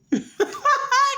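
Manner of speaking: a short high laugh; high burst of laughter. Real, not acted. Vlogger laughs at the girlfriend's gym excuse, short high laugh.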